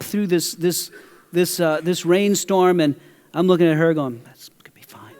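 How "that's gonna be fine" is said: whispered forced reassurance; fake calm whisper. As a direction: whisper it like nothing's wrong. Fakes calm to nervous neighbour.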